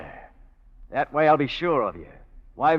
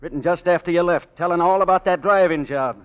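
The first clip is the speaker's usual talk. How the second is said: angry; 6 semitones higher, 8 dB louder than usual. Acted. Gangster accusing her, voice rising.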